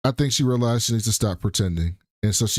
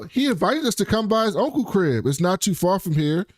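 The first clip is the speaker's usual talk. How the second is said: a bit higher, casual and breezy; lightly voiced Yasmin; 7 semitones higher than usual. Retelling Yasmin pitching the uncle's crib, lightly breezy. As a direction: toss it off lightly like a schemer. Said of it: "And she was like".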